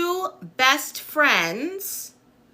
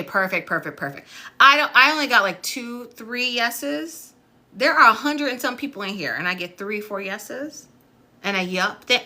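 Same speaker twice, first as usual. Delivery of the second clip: usual speaking voice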